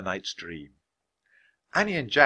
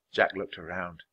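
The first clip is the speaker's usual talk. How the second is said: usual speaking voice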